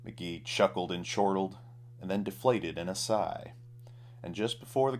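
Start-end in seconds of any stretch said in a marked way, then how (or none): none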